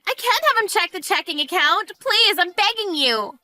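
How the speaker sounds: high-pitched panicked plea; desperate begging. Acted. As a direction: beg them in rising panic. Begs in panic over account.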